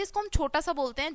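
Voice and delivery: normal talking voice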